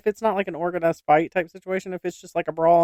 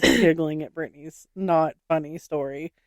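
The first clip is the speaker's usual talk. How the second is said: usual speaking voice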